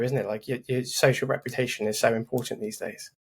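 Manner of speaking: normal talking voice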